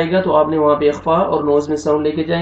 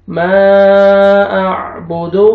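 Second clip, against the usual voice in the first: very slow melodic chanted recitation; drawn-out tajweed recitation; Quranic recitation; 4 dB louder, much slower than usual. Shown as a demonstration. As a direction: chant the verse slowly and evenly. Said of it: "recitation demo".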